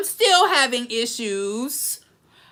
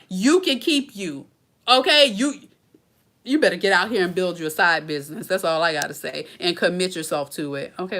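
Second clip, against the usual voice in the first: usual speaking voice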